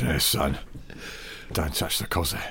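menacing hushed threat; hushed thug voice, lips barely moving; sinister mouth-closed voice. Pretend. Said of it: "dragged someone into a pub toilet to go".

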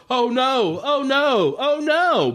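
high, limp "oh no" with no real panic; a hammed-up, half-hearted cry of alarm from a victim making no effort to run; mock helpless victim. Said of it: "just sort of go".

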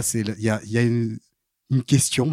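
usual speaking voice

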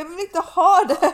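high, loud laughing retelling; laughing as she retells refusing the dress; laughing mock refusal.

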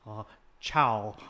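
low sitcom impression of a coworker; low, dopey goodbye; Kevin doing Gabe. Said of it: "he does the impression of Gabe".

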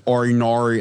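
a light Australian drawl of "Or nor"; Australian accent. Retold or imitated. Copying the Australian dressing-room mate, in an Australian accent. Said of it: "break out into Australian and British accents".

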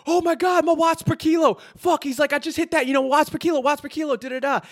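high, loud and frantic; bugging out. Retold or imitated. Cyclist bugging out on the climb over his numbers, high and frantic. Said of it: "he was bugging out".

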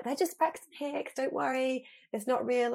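high, slow, mock-soothing coworker; breezy reassurance. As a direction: brush it off, sweetly and high. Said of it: "Charlotte comes in and she's like".

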